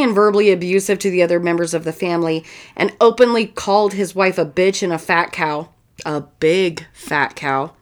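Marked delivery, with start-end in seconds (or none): 6.0-7.7 slow low sneering repeat; mock insult. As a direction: drag out the insult, lower. Mocking the father's insult.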